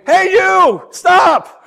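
loud, high-pitched holler; shout. Acted. A stranger in the park yelling at someone walking with friends, shouting. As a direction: yell after someone to halt them. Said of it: "someone shouts your name".

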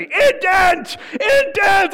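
teacher screaming at crying child, hammed-up yell; imitated screaming teacher. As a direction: bark each word like a furious teacher. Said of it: "she's just screaming at me".

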